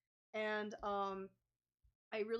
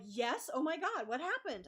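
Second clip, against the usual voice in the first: alarmed office staff; 6 semitones higher, 5 dB louder than usual. Retold or imitated. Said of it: "And they're like".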